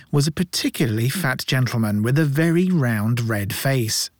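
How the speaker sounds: ordinary delivery, nothing marked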